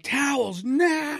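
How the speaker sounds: high, stretched-out mock command; frantic order. Dad demanding towels for the leak, frantic drawn-out order. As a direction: call out urgently, drawing each word out.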